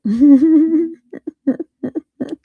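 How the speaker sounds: long, high, loud laugh; hearty laughter. Real, not acted. Host finds the video very accurate, laughing heartily. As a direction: keep laughing, loud and high, in real amusement.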